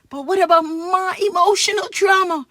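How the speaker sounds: loud, hammed-up anguish; theatrical whine of suffering; mock outraged wail. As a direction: overplay the victim, loudly. Kid hams up his lawsuit.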